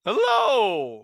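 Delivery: loud hello falling steeply from a high start; Criddler. Acted. The Criddler making an entrance, loud showy greeting. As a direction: call out a big showy entrance. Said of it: "Criddler always comes in with".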